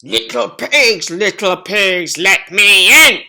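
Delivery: loud demanding wolf call; wolf roar. Acted. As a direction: roar it at the door. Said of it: "roared the wolf".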